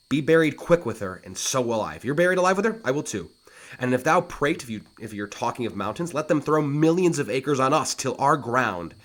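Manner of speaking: boasting challenge. Acted. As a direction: top every boast. Hamlet's escalating boast.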